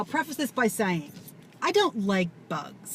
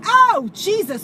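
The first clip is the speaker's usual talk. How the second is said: high, loud groan of disgust; horrified at the pus; appalled; 11 semitones higher, 9 dB louder, slower than usual. Retold or imitated. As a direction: recoil in horror. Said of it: "So I'm like".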